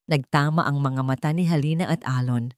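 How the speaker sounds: ordinary delivery, nothing marked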